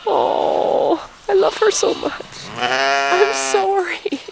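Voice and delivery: high, slow, tear-choked voice, breaking on each word; crying. Real, not acted. Rancher crying over her ewe's lost lamb, voice breaking. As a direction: sob it out, barely holding together.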